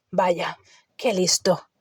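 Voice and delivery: slow, grumbling Hagrid voice; growled sarcasm. A narrator's reading. Hagrid answering Harry's cheek, slow sarcastic growl. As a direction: growl it out, unimpressed. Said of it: "gruñó Hagrid".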